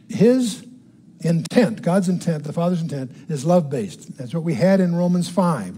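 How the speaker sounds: normal talking voice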